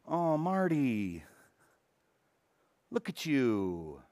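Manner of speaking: gently, as if kneeling over a wounded man; tender pity. Retold or imitated. Jesus speaks tenderly to the wounded Marty, slow and soft. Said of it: "comes along and goes".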